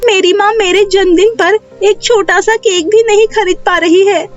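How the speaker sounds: put-on high-pitched child voice, aggrieved; complaining young girl. Neha tells the fairy about her birthday cake trouble, complaining high child voice. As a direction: pour out a child's grievance, pitched well up.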